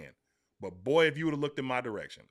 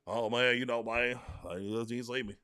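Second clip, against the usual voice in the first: mock timid mumble; 4 semitones lower, 6 dB quieter than usual. Mocking a receiver who stays quiet, softer and lower. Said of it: "be quiet and be like".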